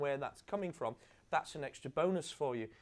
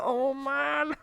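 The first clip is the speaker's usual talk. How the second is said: embarrassed groan; 12 semitones higher, 7 dB louder than usual. Retold or imitated. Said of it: "Ford was like that".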